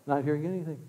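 ordinary delivery

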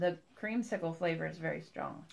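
talking between bites; snacking while speaking; mouth full, chewing. Real, not acted. Seen 0: Left speaker keeps putting food to mouth, chewing throughout the words.

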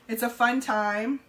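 ordinary talk, nothing marked

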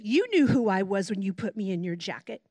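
a low, mocking serpent; cold snake. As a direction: let the snake sneer its reply. Snake answers the victim.

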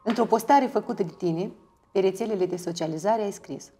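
ordinary talk, nothing marked

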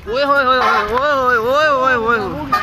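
long, high, loud celebratory hooting; drawn-out cheer. Real, not acted. Celebrating on the roof with friends, slow loud hooting cheer. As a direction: stretch the cheer out slowly. Celebrating on rooftop kite flying.

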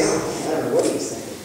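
fast, whispered mock gossip behind the rector's back; half-hearted sympathy whispered out of the side of the mouth; sly whispered aside. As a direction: hush it like a gleeful little secret. Assistant pastor's sly aside about rector.

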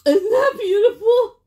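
high, loud, teary and laughing at once; moved to tears. Real, not acted. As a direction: voice cracking with real emotion. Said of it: "you're gonna make me cry".

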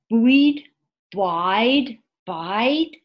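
puzzled tries at BUY with a D stuck on; wrong past form. Shown as a demonstration. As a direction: sound out "Bide?" doubtfully, as a made-up past of buy. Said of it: "that's wrong".

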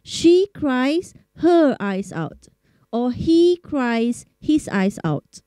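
drawn out, word by word; slow model phrase. Shown as a demonstration. Teacher listing the forms, slowly. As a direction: lay each version out slowly. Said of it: "teacher models variants".

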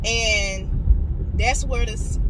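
ordinary talk

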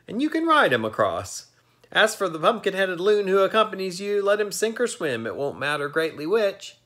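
high, scornful voice for the crabbed ferryman. A narrator's reading. Ferryman mocking the boy and Pumpkinhead, high and sneering. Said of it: "it was not a nice laugh".

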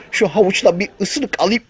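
ordinary delivery

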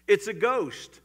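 sudden alarmed outburst; frightened cry. Acted. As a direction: call it out like scared fishermen. Disciples' cry in story.

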